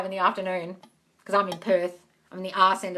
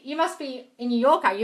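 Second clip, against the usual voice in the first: normal talking voice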